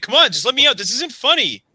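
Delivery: high, anxious plea through a door; trapped man begging his friends; scared pleading. Acted. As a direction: beg to be let out, rattled. GM voices locked-in Jack pleading.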